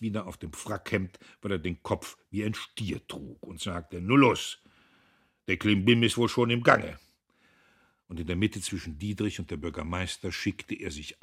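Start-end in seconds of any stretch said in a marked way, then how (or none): 4.0-7.0 loud, high, brusque order; hearty bluster at high volume; blustering command. A narrator's reading. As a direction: get them moving in a booming voice. Blustering president hurries everyone along.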